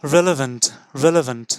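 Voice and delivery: relevant said clearly, falling, for students to copy; teacher leading a repeat drill; repeat after me. Shown as a demonstration. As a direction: model relevant for the class to repeat. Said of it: "Repeat after me".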